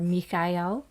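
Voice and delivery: "Michael" said mee-kai-EL, with a k sound; Hebrew-like pronunciation. Shown as a demonstration. Host offering another name for the archangel, "Michael" as mee-kai-EL. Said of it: "Michael or Michael or Michael".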